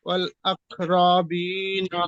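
normal talking voice

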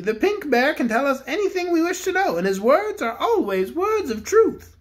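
the Bear King addresses the cook; kindly Bear King. Acted. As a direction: speak as a gentle storybook king. King speaks.

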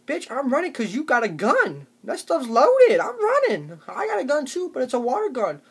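high, loud, frantic explaining; panicked yelling. Acted. Comedian explaining why he runs from guns, high panicked yell. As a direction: bolt from the gun while shouting. Running from a gun.

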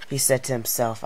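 ordinary delivery, nothing marked

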